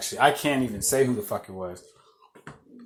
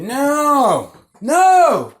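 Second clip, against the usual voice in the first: put-on high, loud little-boy no; toddler's shrill refusal; child yelling no; 11 semitones higher, 6 dB louder than usual. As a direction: whine it like a stubborn toddler.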